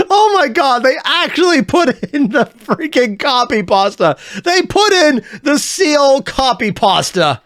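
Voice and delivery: real delighted shock at the meme; excited disbelief. Real, not acted. Spotting the seal copypasta in the video, excited shriek.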